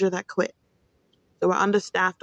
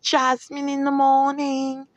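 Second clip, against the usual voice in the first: slow, higher announcer voice; radio host sign-off; 6 semitones higher, slower than usual. Acted. Podcast host naming her show to listeners, slow announcer voice. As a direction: stretch out the show name like a DJ. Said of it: "Once again, this is".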